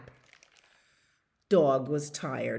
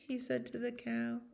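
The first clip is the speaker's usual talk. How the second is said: plain reading voice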